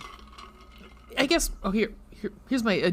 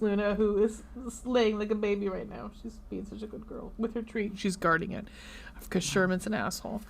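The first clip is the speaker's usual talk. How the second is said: much softer, near whisper; hushed; 9 dB quieter than usual. Real, not acted. Talking about the resting dog, much softer and hushed.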